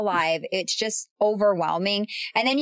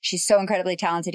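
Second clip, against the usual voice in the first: normal talking voice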